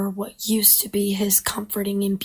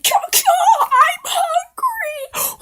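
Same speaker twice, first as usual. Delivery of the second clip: much higher, louder caws; vulture cawing; 7 dB louder, slower than usual. Acted. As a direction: screech caw caw like a vulture. Vulture cries cut in.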